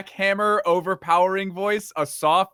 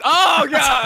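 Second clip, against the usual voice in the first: shout; 10 semitones higher, 7 dB louder than usual. Pretend. Table flipped, chaos.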